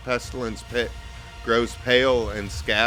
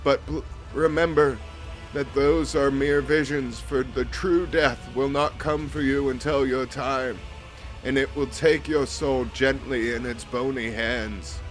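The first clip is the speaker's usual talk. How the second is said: higher, grave priestly voice; solemn vizier; 4 semitones higher than usual. Acted. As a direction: speak as an ancient cult priest.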